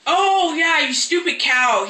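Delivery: loud, high imitated insult; mock cussing out. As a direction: cuss her out, hammed-up and drawn out. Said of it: "He cusses her out".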